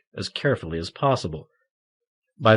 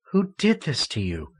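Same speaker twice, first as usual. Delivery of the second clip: quavering voice; 6 semitones higher than usual. Acted. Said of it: "Marion's voice quavered".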